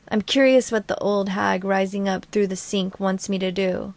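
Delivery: ordinary delivery, nothing marked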